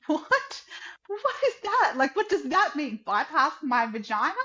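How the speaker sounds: high, rising cries of disbelief; stunned, can't believe what she just heard; sudden high-pitched alarm; shocked disbelief. Retold or imitated. Shocked reply to the doctor.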